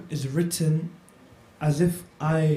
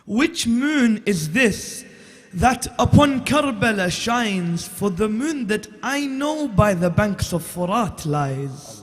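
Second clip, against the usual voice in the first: slow, lifted elegy, louder on the repeat; poem recitation; 4 semitones higher, 6 dB louder, much slower than usual. Acted. Reciter opens his Karbala poem for the gathering, mournful declamation. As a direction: declaim the lament line by line.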